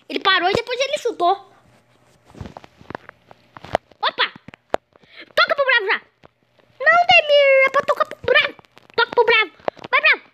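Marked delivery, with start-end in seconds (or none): none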